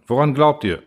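ordinary delivery, nothing marked

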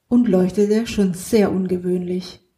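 ordinary delivery, nothing marked

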